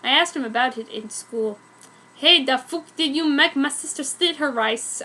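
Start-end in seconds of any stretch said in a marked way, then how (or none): none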